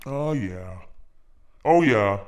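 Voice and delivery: usual speaking voice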